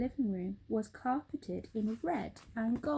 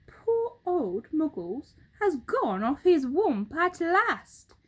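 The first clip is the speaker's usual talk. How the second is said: louder mock pity; roly-poly bird mocking; 6 semitones higher, 8 dB louder than usual. Bird mocking Mugglewump's madness, loud mock pity. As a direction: tut at his madness.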